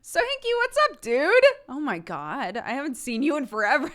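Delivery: high, bouncy vintage cartoon greeting; chirpy old-timey cartoon voice; cartoon character voice. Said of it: "sounded like I was trying to imitate a cartoon character".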